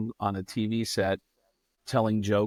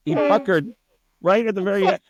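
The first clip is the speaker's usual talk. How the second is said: normal talking voice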